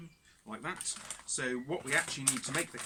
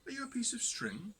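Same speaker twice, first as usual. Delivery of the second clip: barman's question; 9 semitones higher than usual. Acted. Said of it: "he says".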